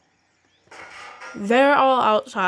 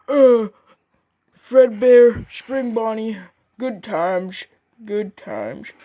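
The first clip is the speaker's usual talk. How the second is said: nostalgic boss man; 5 dB louder, slower than usual. Acted. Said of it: "He was like".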